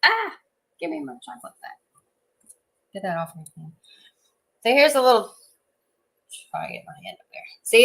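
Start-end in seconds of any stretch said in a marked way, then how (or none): none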